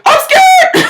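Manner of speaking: very high, loud squeal of fear; hammed-up panicked scream; mock terrified yell. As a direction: imitate a man shrieking in fright. Voices frightened man holding dog.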